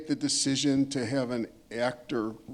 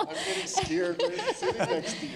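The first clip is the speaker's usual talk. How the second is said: said through real laughter, pitched way up; laughing. Real, not acted. Moderator teasing the angry panelist beside her, laughing. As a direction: tease her while laughing.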